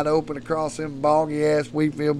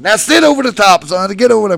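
raised, high, urgent coaching; shouted instructions; 6 semitones higher, 10 dB louder, faster than usual. Retold or imitated. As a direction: call orders out to the boy on the bull. Retold instructions shouted to son.

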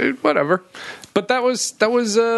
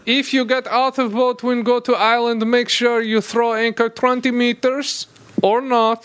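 mock Croatian; 4 semitones higher than usual. Mock Croatian skipper, broken English.

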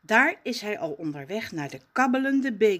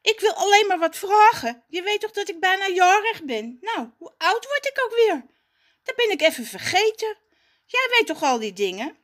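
put-on higher, louder, fast pleading boy; nervous eager Raf; 12 semitones higher, 7 dB louder, faster than usual. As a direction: rush the question nervously.

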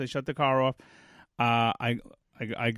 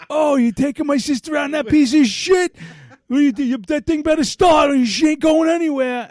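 high, loud jeering yell; bully shouting across the yard; taunting shout; 13 semitones higher, 9 dB louder than usual. Retold or imitated. Brothers mocking his car.

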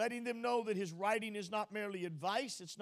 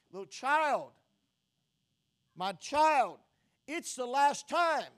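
loud, forceful fatherly warning; authoritative warning; 4 semitones higher, 10 dB louder, slower than usual. Acted. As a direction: boom it out slowly as a stern warning to a child. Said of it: "this is like a warning of an authority".

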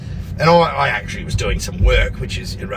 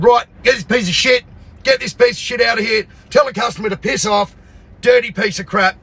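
raised, heated rant at the mechanic; angry rant; 7 semitones higher than usual. Retold or imitated. Ranting at mechanic, yelling.

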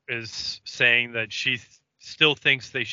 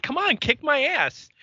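much higher-pitched imitation of goading; insistent mock challenge; retold taunt. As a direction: keep daring him to fight. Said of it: "she just keeps going".

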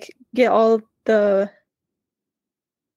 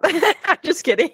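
laughing; 5 semitones higher than usual. Real, not acted. Joking about her birthday.

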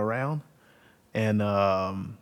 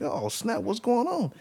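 put-on higher amazed kid voice; surprised kid; 12 semitones higher than usual. Kid amazed the uncle rides in the rain, surprised. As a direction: blurt it out like a wide-eyed kid. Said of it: "I'm like".

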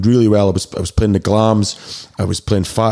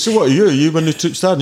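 sneering corporal; 7 semitones higher than usual. Retold or imitated. Bitter corporal challenges the new TA sergeant, mock sneer. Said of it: "the boy comes up and he says".

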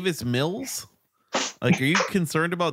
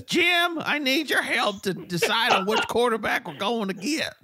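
Marty Hurney impression; 10 semitones higher than usual. Host mimics the GM calling Jim for quarterback advice, pitched way up. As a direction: put on the GM's voice asking Jim for help. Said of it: "That's how he talks".

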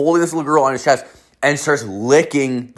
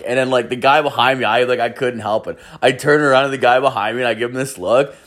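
normal talking voice

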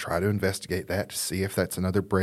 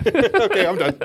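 ordinary talk, nothing marked

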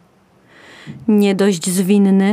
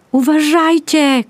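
high, loud warning shout; 7 semitones higher, 4 dB louder than usual. A narrator's reading. Pony shouts a warning at the horses. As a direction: shout a warning over the noise. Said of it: "krzyknął".

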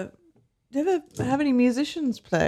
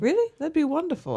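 surprised; 8 semitones higher, 3 dB quieter, faster than usual. Acted. Bard offered a free room.

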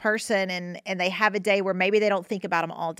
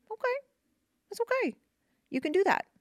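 soothing reassurance; 13 semitones higher, faster than usual. Real, not acted. Comforting someone stuck in grief.

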